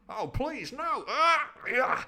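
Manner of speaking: high-pitched begging into a cry; hammed-up victim's plea; mock pleading. Poor old Alan being stabbed, mock pleading. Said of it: "poor old Alan".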